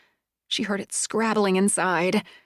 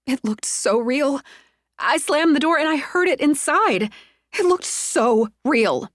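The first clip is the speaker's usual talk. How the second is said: shaken; 7 semitones higher than usual. Acted. Shaken Sonia telling friends what she saw. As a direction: still shaking from the scare. Shaken woman after fright.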